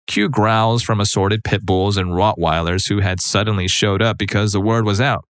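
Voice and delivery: ordinary delivery, nothing marked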